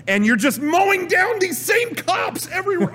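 high-pitched comic shout of exasperation; exasperated ranting. Acted. Player ranting about endless identical cops, exasperated high shouting.

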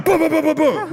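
high, rapid-fire imitated gunshots; mouthed gunfire booms. Player imitating the game's shotgun fire, excited mouthed booms.

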